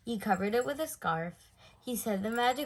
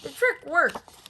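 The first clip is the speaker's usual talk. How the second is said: higher, louder delighted voice; delighted old friend; 7 semitones higher, 7 dB louder than usual. A narrator's reading. Friend delighted that the bear's trick worked. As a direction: beam at the bear's success. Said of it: "said Mister Grubber".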